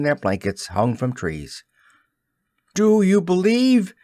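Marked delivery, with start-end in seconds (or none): 2.7-3.9 put-on loud, much higher plea to all children; urgent cry. A narrator's reading. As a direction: call out to every dreaming child. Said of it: "he cried".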